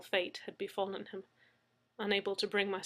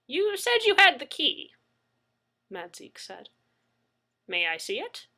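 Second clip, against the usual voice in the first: put-on higher, louder eager old man; Mad Zeke voice; 6 semitones higher, 7 dB louder than usual. A narrator's reading. Mad Zeke asks Leslie for the key, eager. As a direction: ask keenly.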